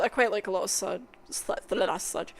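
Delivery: ordinary talk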